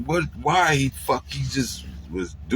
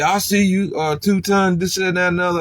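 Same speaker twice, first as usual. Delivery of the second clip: hailing friends; 8 semitones higher, 4 dB louder than usual. Retold or imitated. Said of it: "everybody said".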